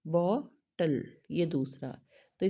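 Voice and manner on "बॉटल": बॉटल split into बॉ and टल; two separate beats for बॉटल, a gap before टल; syllable by syllable. Shown as a demonstration. Teacher shows students two units, बॉटल broken into बॉ, टल. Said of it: "एक syllabic unit".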